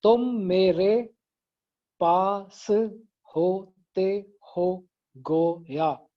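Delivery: slow, syllables counted out one by one. Shown as a demonstration. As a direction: beat out each syllable slowly. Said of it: "जरा गिनना शुरू करें".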